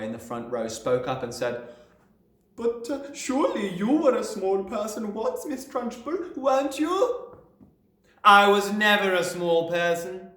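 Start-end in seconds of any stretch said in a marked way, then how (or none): 2.5-7.2 put-on high, hesitant child; timid boy. A narrator's reading. Small boy daring to question the headmistress. 8.2-10.3 slow, loud, high retort; snapped. A narrator's reading. Headmistress retorting to a pupil, snapping. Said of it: "she snapped".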